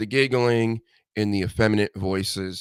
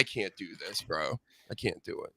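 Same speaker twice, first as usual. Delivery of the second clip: softer, giving-up mutter; quiet; 6 semitones lower, 14 dB quieter than usual. Retold or imitated. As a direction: drop the voice, a weary I-give-up. Giving up on these guys.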